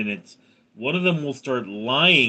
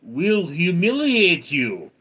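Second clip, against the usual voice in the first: higher, slow mock Slavic accent; Ukraine's defiant comeback; 5 semitones higher, 3 dB louder, slower than usual. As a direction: draw out the comic retort in accent. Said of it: "And Ukraine goes".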